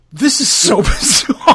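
loud, slowed words broken by a real laugh; laughing disbelief. Real, not acted. Reading the news to a cohost, laughing out loud at how bizarre it is.

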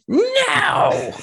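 very high, loud tantrum "no"; shouting child impression. Child as a metal band's backing vocal, mock screamed "no". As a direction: screech it like a child over metal. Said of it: "a child be like".

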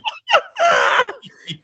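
very high, loud burst of laughter; shrieking laugh. Real, not acted. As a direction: let out a howling shriek of laughter. Bursts out at prayer joke.

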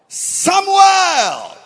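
high, loud, long shouted name; divine call. Acted. The Lord calling the boy, booming shout.

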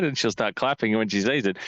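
ordinary talk, nothing marked

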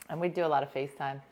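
ordinary delivery

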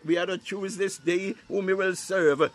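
ordinary talk, nothing marked

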